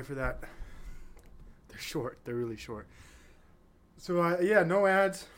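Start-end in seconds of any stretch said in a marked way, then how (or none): 4.0-5.3 winded from running; out of breath. Real, not acted. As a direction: gasp it out after a run. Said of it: "Sorry, I'm out of breath".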